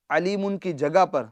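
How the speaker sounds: ordinary talk, nothing marked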